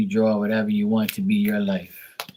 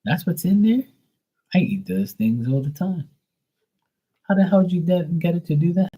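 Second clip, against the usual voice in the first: voicing the dog's shock; 10 semitones higher than usual. Voicing her dog shocked by the cheese stick, high mock outrage. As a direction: squeal it like a stunned little dog. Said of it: "was like".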